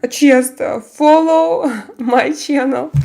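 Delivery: amused, laughing. Real, not acted. Streamer joking about her cat advertising, laughing.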